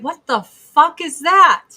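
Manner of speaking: loud, drawn-out mock bewilderment; incredulous outburst, hammed up; baffled friends. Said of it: "everyone's like".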